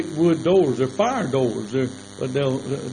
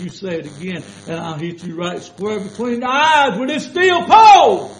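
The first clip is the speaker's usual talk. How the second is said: threat rising into a high, loud shout; 5 semitones higher, 7 dB louder than usual. Retold or imitated. Warning the cursing coworker, a high loud shout. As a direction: yell the last words at him like a warning.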